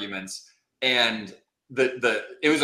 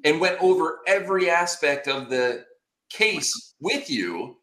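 incredulous rant climbing higher in pitch; retold indignant disbelief; 6 semitones higher than usual. Client to his unprepared lawyer on trial day, mounting indignation. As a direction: scold in mounting disbelief, dragging out key words.

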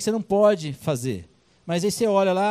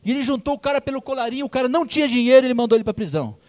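fast, higher tattling report; eager gossiping witnesses; 6 semitones higher, faster than usual. Acted. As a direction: rush to tell the king. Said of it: "foram contar pro rei".

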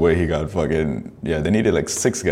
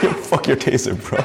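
laughing quote; 7 semitones higher than usual. Retold or imitated. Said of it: "He's like".

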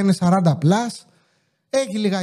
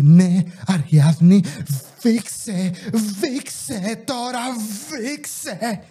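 imitating the mother; much slower than usual. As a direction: parody the mother telling her daughter to go on and cough, dropping away at the end. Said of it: "και λέει". (σαρκαστική, αργή μίμηση της μάνας που σβήνει στο τέλος)

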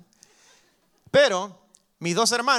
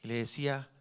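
ordinary talk, nothing marked

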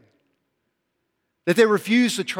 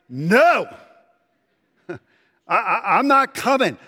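put-on defiant kid; 4 semitones higher than usual. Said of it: "all you hear back is".

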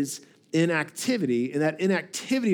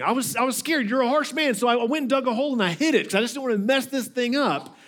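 higher, hurried pleading of a made-up excuse; mock scared excuse; 7 semitones higher, faster than usual. Servant explaining the hidden money to his master, mock scared. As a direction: plead your case, acting scared. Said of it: "that he was scared".

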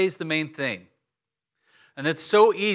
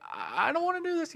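whining; 14 semitones higher, 7 dB quieter than usual. Retold or imitated. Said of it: "I'd be like".

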